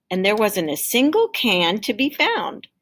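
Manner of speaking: ordinary delivery, nothing marked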